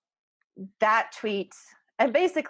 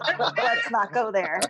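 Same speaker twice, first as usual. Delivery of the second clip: laughing at own bad joke, slower; 2 semitones higher, slower than usual. Real, not acted. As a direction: giggle it off, shaking your head.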